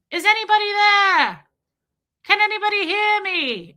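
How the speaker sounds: high, loud mock call into the dark; desperate calling out. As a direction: call out like a lost survivor. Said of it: "he's like".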